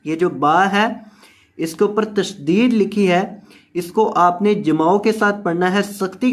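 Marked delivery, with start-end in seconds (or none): none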